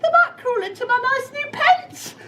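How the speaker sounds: very high, stammering frightened voice with giggles; scared cook. Acted. Cook asked to dig, very high stammering fright. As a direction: quaver it, heart thumping. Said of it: "the cook declared".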